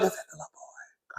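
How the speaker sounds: mock hushed whisper; whispered quote. Replaying her own whispered question, whispered. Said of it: "I'm whispering".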